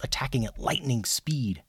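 ordinary delivery

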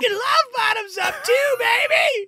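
high hyped-up crowd call; excited shout. Acted. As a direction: pump up the room. Hannigan hypes the crowd.

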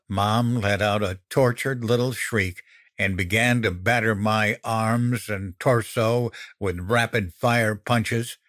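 ordinary delivery

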